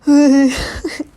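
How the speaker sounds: high, loud fake sob; hammed-up crying whine; mock wailing. Stranded boy with no adults, mock crying. Acting panicked Piggy.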